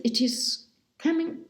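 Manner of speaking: ordinary delivery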